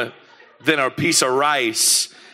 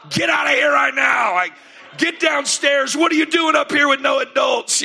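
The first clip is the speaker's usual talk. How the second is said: put-on yelling at kids; 12 semitones higher than usual. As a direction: bark orders like an angry dad. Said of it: "I'm yelling at all the kids".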